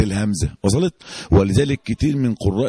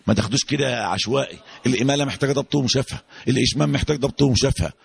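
usual speaking voice

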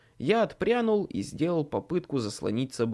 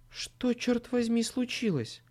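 Murphy's shocked question; 9 semitones higher, slower than usual. Acted.

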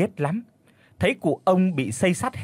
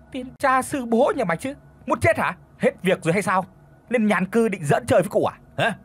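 much higher, shouting abuse; furious cursing; 8 semitones higher than usual. A narrator's reading. Old master screaming curses at the boy.

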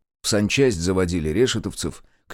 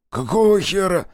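higher, louder shocked outburst; stunned, can't believe the order; astonished; 11 semitones higher, 4 dB louder than usual. A narrator's reading. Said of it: "изумился Кузьма".

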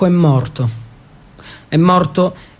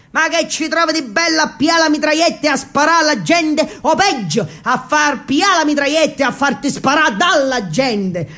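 mother's voice pitched higher, in dialect; higher, scolding southern mother; 6 semitones higher than usual. Acted. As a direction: nag him like a dialect-speaking mamma. Mother scolds son about army.

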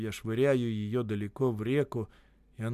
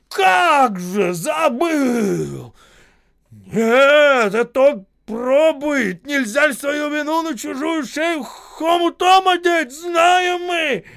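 high, very loud outraged yelling; furious shouting; 13 dB louder, much slower than usual. A narrator's reading. Боев exposing Осип's excuse, shouting furiously. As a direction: drag out the words in scornful fury. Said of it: "яростно кричал Боев".

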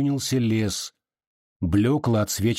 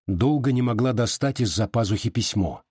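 usual speaking voice

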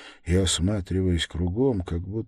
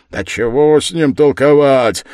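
loud, raised, impatient yell; shouting yard-keeper; 7 semitones higher, 12 dB louder than usual. A narrator's reading. Huge yard-keeper done talking with the stranger, shouting. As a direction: cut off the argument with a shout. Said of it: "крикнул другой дворник".

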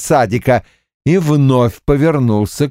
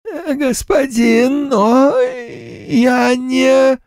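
ghost voice; much slower than usual. Acted. Ghost servant hesitant.

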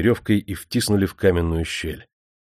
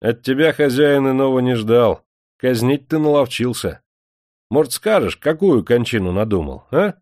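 higher, louder cheeky taunting; mock him unafraid; 6 semitones higher, 5 dB louder than usual. A narrator's reading. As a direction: prisoner cheekily taunts his master. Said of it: "опять озорно отозвался".

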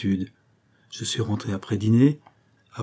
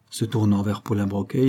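usual speaking voice

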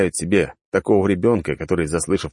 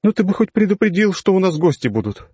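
much higher, gently scolding tone; reproachful; 13 semitones higher, 3 dB louder than usual. Acted. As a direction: chide him for not warning. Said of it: "укоризненно покачала головой она".

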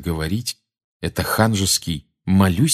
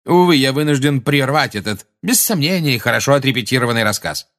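higher, louder mock regret; very contemptuous; 6 semitones higher, 4 dB louder than usual. A narrator's reading. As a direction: interrupt with lofty false apology. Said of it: "с чрезвычайно презрительным видом".

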